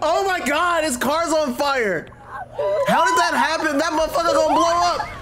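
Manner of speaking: high, laughing shouts of disbelief; cracking up while yelling. Real, not acted. Laughing at burning car.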